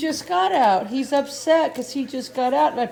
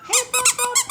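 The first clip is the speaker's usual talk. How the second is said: high pet call. Real, not acted. Calling the puppy over.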